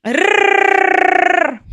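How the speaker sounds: "Rrrr" as a long high rolled trill; Russian R. Shown as a demonstration. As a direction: roll "Rrrr" hard with the tongue tip. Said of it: "Do you feel the tongue?".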